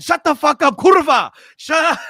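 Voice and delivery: high, loud mock Polish yell; Polish accent. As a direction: imitate an angry Polish fan yelling. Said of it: "in a Polish accent".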